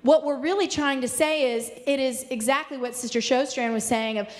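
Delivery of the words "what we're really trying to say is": ordinary talk, nothing marked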